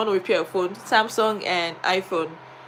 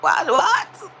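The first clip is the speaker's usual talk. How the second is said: retold distress; 8 semitones higher, slower than usual. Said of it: "I was really crying".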